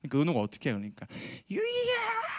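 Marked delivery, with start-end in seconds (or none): 1.5-2.3 put-on high wailing cry of a child. Preacher imitating his daughter wailing at her brother. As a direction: imitate a sobbing child calling out. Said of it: "하고 막 울기 시작한 거야".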